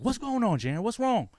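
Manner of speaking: lightly voiced mother, pitched high; mom's worried question. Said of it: "My mom's like".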